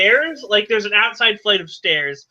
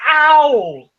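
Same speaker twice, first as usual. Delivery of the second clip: higher, louder mock yelp; comic ow; 4 semitones higher, 5 dB louder than usual. Lena pokes the eye patch, yelping in mock pain. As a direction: yelp as if poking a sore eye. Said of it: "She pokes it and goes".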